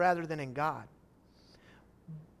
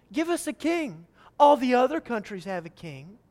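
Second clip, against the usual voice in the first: put-on childish whine; 4 semitones higher, 6 dB louder than usual. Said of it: "Listen to the childishness of their request".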